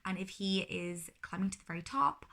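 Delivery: ordinary talk, nothing marked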